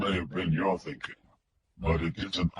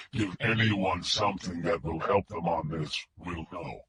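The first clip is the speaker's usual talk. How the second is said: mechanical filtered voice; 4 semitones lower, 8 dB quieter than usual. A narrator's reading. Kidnapper on the phone to Wingo, mechanical filtered voice. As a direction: speak like a voice through a distortion filter. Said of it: "The filtered voice was mechanical".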